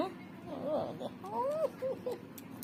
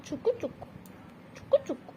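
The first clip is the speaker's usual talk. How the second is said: high cooing চুকু চুকু; 10 semitones higher, 6 dB quieter than usual. Real, not acted. Cooing baby talk to pet.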